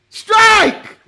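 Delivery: high, loud shouted call; shouted catchphrase. Retold or imitated. Co-host suddenly remembering an NXT moment, shouting. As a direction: belt out the word like a catchphrase.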